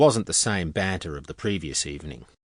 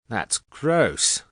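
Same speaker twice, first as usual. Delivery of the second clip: disgusted; 4 semitones higher than usual. A narrator's reading. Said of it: "her nose wrinkling".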